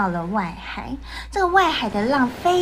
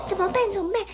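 worried; 7 semitones higher, much faster than usual. Acted. Panicked worry.

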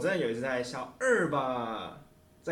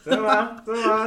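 high, loud disbelief; surprised; 9 semitones higher, 8 dB louder than usual. Real, not acted. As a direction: gasp it out in shock. Shocked reaction to the news.

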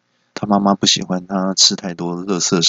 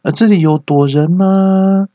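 high, loud drawn-out searching call; 11 semitones higher, 5 dB louder, slower than usual. Retold or imitated. Classmate searching during hide-and-seek, high calling-out question. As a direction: call out slowly, stretching the final word. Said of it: "同学只要跑到附近都会问说".

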